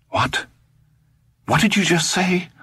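high, shaken double take; stunned disbelief. A narrator's reading. Todd stunned by the old man's threat. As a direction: struggle to get the words out. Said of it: "had to try twice before he could speak".

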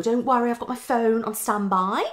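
normal talking voice

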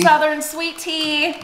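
lightly hammed-up Southern lilt; mock Southern accent. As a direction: put on a cutesy Southern accent. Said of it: "That's my Southern accent".

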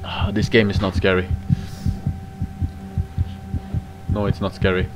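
very low, soft murmur; hushed, deep self-soothing; self-reassurance. Real, not acted. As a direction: talk yourself out of fear, low and quiet. Reassuring self during horror game.

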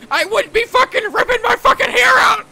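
high, loud, exasperated outburst; hammed-up furious yell. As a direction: yell it in mock fury at mother. Acted quarrel with his mother.

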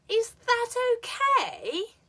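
very high, slow, OKAY dips and rises; high, loud fall-rise on "okay"; polite fall-rise question. Shown as a demonstration. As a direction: stretch "okay" into an upward swoop. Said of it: "this intonation is supposed to make questions sound".

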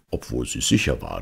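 ordinary delivery

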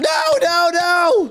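panicked scream. Acted. Friend being attacked.

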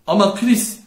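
mildly shouted objection. A narrator's reading. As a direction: call out over loud party music. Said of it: "bağırmak zorunda kalmıştı".

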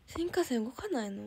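ordinary delivery

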